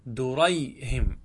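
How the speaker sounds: دريهم drawn out slowly, syllable by syllable; slow demo of دريهم. Shown as a demonstration. Teacher to students, دريهم said slowly and clearly. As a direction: spell out دريهم slowly for the class. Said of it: "فتقول في درهم".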